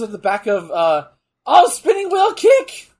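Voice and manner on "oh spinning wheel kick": slow, higher, louder yell; excited shout. Real, not acted. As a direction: call the move like a fan. Reacting to a move.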